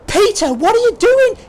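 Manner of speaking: high, loud alarmed call; alarmed shout. Acted. Disciples in the boat shout at Peter on the water, alarmed. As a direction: yell across the water in alarm.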